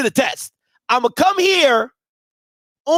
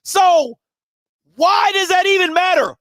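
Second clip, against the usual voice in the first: raised, heated rant voice; 5 semitones higher than usual. Real, not acted. Heated rant about coach.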